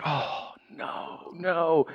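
hushed, breathy mock dismay; dread. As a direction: groan softly at who is calling. Dreading a relative's call.

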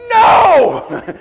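high, full-volume comic no; mock-outraged shout; retold shouted refusal. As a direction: refuse with a hammed-up yell. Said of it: "sorry for yelling at me".

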